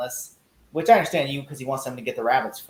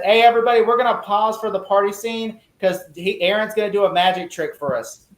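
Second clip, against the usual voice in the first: mock director's announcement, pitched up; raised-voice retold announcement; calling to everyone; 5 semitones higher, 5 dB louder than usual. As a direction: imitate rallying the whole set, higher voice.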